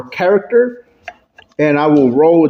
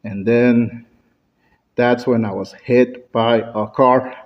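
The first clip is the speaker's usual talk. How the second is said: low, subdued, sad delivery; halting, lowered voice of mock sorrow; sad storytelling; 5 semitones lower, 3 dB quieter than usual. Shown as a demonstration. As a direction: bring the voice down for a sad tale. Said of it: "a sad story".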